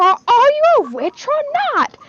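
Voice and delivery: high, loud exasperated yell; yelling Ron. Retold or imitated. Fan acting out Ron yelling at Hermione, loud and high. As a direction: imitate a boy shouting at his panicking friend. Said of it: "Ron just yells at her".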